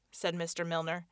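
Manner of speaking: plain reading voice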